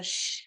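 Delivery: sustained sh hiss; sh sound. Shown as a demonstration. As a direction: breathe out a long sh. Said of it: "we already did one sound".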